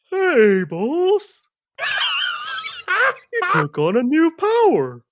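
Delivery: loud, very high cartoon bear; hammed-up, excited sidekick hollering; Yogi Bear. Bear greets the boss on a new power, loud cartoon voice. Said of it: "do that Yogi Bear thing".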